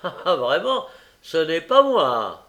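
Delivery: ricanement moqueur, fort et lent; en ricanant. A narrator's reading. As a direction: ricaner d'un air narquois. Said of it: "dit Noé en ricanant". (Noé ricane d'Olivier, fort et narquois)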